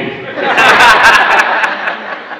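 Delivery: loud burst of laughter; hearty laugh. Real, not acted. Laughing at nephew's reply. (carcajada fuerte)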